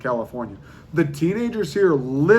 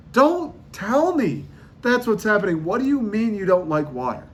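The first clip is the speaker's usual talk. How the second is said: higher, incredulous protest; can't believe what you're hearing, pitched up; stunned disbelief at someone refusing water; 7 semitones higher than usual. Real, not acted.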